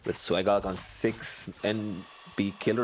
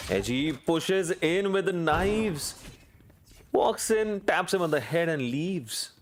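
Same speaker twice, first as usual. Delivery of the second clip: excited; 8 semitones higher, slower than usual. Real, not acted. Caster thrilled by a knife kill.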